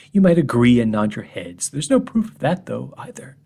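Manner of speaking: ordinary delivery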